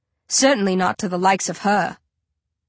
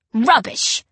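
snorting; 4 semitones higher, 3 dB louder than usual. A narrator's reading. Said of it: "she snorts".

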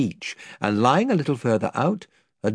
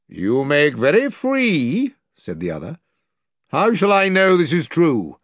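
doubting lord; 5 semitones higher, 4 dB louder than usual. Acted. The lord challenging Caspian's claim, skeptical.

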